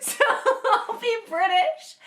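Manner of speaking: high, slow, through laughter; laughing. Retold or imitated. Caller recalling the fake British plan, laughing. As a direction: giggle out the decision.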